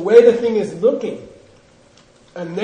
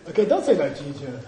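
ordinary talk, nothing marked

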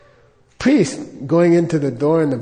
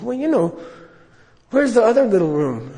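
higher, searching question; curious Lama; 6 semitones higher than usual. Retold or imitated. Lama asking the priest for the other room, curious. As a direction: look around puzzled. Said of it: "He says".

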